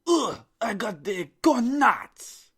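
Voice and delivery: very loud, high, slow fake German accent; badly faked German accent, full-force yell; aggressive fake German. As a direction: shout it like an enraged German dictator. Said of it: "More aggressive".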